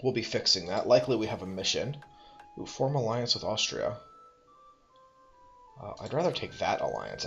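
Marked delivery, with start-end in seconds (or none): none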